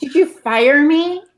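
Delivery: slow, mock-hurt question; mock shocked. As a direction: play it wounded and surprised. Mock alarm at being replaced.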